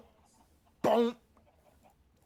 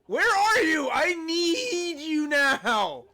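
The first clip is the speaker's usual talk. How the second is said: melodramatic wail; 6 semitones higher, 5 dB louder, much slower than usual. Pretend. Dramatic plea to Copper.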